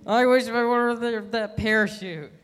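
high, loud panicked wail; panicked regret. Acted. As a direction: cry out in mid-fall. Passenger falling, regretting.

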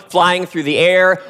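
ordinary delivery

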